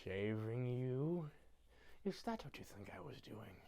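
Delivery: low, very soft, slow menace; Grinch. Retold or imitated. Grinch stopping after Cindy thanks him, low hushed mock menace. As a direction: drop to a hushed, incredulous creep. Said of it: "He stops and it's just".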